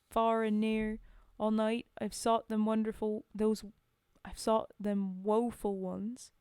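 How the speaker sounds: a put-on British soldier's accent for the poem. Reciting a soldier's war poem aloud, in a put-on accent. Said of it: "Raven's terrible accent to lift you up".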